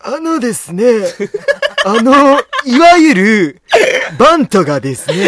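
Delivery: high, loud put-on announcer-ish voice; impression of Kakefu. Doing Kakefu for his partner, high and hammed-up. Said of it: "それ掛布だから。".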